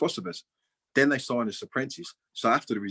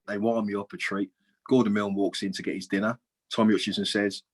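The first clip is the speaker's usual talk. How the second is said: ordinary talk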